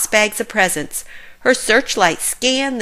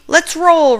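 higher, louder, ready to go; eager exclaim; 8 semitones higher, 4 dB louder than usual. A narrator's reading. Ryder sends the pups to their vehicles, eager call. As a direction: launch the team into action. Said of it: "Ryder exclaimed".

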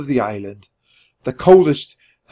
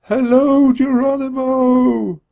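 high, slow, distant call; slow high ghostly voice from far off; far away voice; 12 semitones higher, 4 dB louder, slower than usual. Acted. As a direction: sound like a voice down a crackly line. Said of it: "It sounded very far away".

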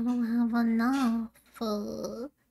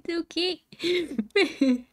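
slow drawn-out okay; 6 semitones higher, much slower than usual. Acted. Player soothes herself over the failed level, slow drawn-out reassurance. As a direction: stretch "It's okay" out very slowly.

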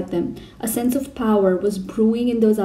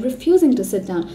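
ordinary delivery

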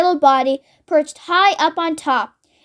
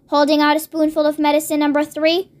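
ordinary delivery

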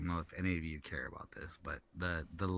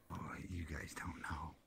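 whispering; 9 dB quieter than usual. Real, not acted. Teasing aside to friends.

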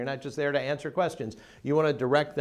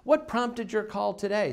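higher and slow, stretching each word; slow, clear question; 8 semitones higher, slower than usual. Shown as a demonstration. Receptionist asking a new caller, slow and high. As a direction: ask it slowly and warmly. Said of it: "here's a great question".

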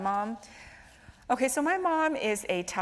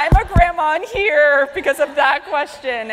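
louder, higher, slow mock retort; mock indignant; 8 semitones higher, 12 dB louder than usual. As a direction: fire back in playful outrage. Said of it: "And I'm like".

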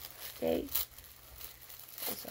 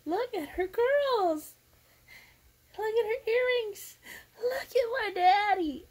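tearful admiration; 10 semitones higher, 5 dB louder than usual. Real, not acted.

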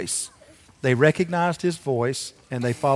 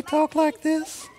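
put-on falsetto, far above usual; high-pitched voice; 12 semitones higher, 3 dB quieter, slower than usual. Shown as a demonstration. Pastor asking children if Jesus talked differently, high-pitched voice. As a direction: leap up into a silly high disguise. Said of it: "if it was high pitch".

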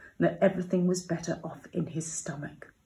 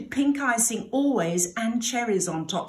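ordinary delivery, nothing marked

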